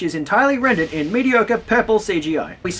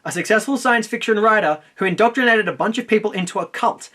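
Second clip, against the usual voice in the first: normal talking voice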